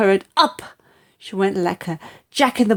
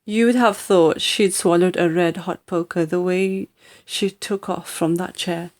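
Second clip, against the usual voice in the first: plain reading voice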